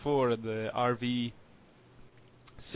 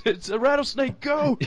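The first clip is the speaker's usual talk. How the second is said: panicked yell; 12 semitones higher, 4 dB louder than usual. Retold or imitated. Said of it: "Whereas Homer just goes".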